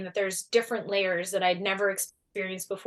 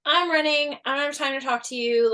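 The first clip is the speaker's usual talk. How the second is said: higher, louder hurried brush-off, retold; impatient brush-off; 6 semitones higher, 5 dB louder than usual. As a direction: snap back at a stranger mid-run, a bit hammed. Brushing off stranger while running.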